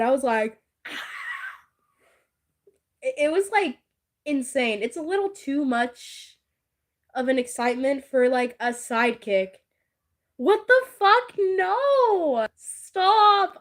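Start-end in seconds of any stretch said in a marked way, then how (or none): 0.8-1.5 tiny, very high stifled squeal; mock inward scream. Fan reliving excitement over favorite character, stifled mock scream. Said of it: "I screamed, like, inside". 10.4-13.6 loud, high outburst; scandalized protest. Real, not acted. Streamer told something weird by Zenny, scandalized protest.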